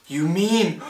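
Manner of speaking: high, astonished question cut by a gasp. A narrator's reading. Horton learns of the tiny town, gasping in surprise. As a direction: break off into an audible gasp. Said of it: "Horton gasped".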